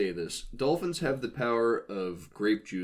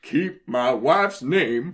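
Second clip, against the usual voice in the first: Will Smith impression; 4 semitones higher, 8 dB louder, much slower than usual. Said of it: "I don't like the Will Smith genie".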